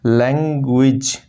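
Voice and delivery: लैंग्वेज said slowly as lang-gij, nasal ng clear; slow model pronunciation. Shown as a demonstration. Teacher slowly showing the nasal in language. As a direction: stretch लैंग्वेज to show the ng. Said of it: "तो इंग".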